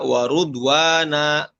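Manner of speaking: WARUDWANA with a u after the r; dhammah on ra. Shown as a demonstration. Teacher showing the variant reading, dhammah in WARUDWANA. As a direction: say "warudwana" with rounded ru. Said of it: "roknya dom, dhammah".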